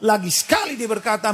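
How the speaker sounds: ordinary talk, nothing marked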